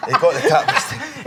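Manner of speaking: laughing. Real, not acted. [name] laughs at the host after she taps, laughing. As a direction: chuckle it out as you win.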